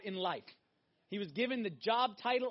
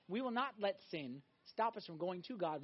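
usual speaking voice

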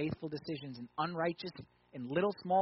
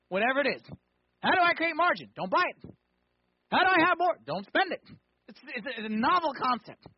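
high, loud, emphatic preaching; forceful preaching; 7 semitones higher, 8 dB louder than usual. Acted. Preacher driving home how to create margin, loud and emphatic. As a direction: hammer it home loudly to the congregation.

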